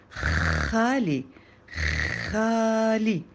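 خالي with a long, rasped kh at the start; exaggerated correction. A narrator's reading. Classmate correcting Joud's speech, خالي with an overdone kh. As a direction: draw out the throaty خ in خالي slowly. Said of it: "ضحك سمير وقال".

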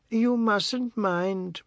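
high, very slow matriarch voice; Missus Tulsi's soothing plea. A narrator's reading. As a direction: draw each word out high and slow, placating.

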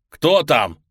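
loud call through the door; shouting. Acted. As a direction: shout it at the hatch. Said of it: "кричу я".

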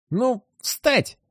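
high creaking ghost command; creaky dead man's voice. Acted. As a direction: creak out the order, high and hollow. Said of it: "скрипит голос покойного".

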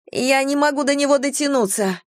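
high, lightly strained voice; gasped out. A narrator's reading. Vanetta reaching for Kieran's hand, high strained gasp. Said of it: "выдохнула Ванетта".

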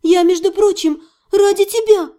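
high, upset protest; trembling voice. A narrator's reading. Heroine justifying herself to her fiancé, high and mildly trembling. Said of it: "сказала я дрожащим голосом".

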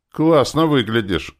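high, loud sneering compliment; mocking. A narrator's reading. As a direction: jeer at how they look. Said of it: "насмешливо сказал другой урод".

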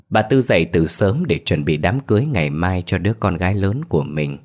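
usual speaking voice

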